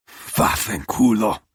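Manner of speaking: slow, loud Italian swear; Italian curse. Acted. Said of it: "he curses in Italian".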